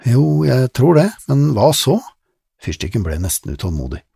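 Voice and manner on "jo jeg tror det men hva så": put-on girl's voice. Lizzie voiced as a girl.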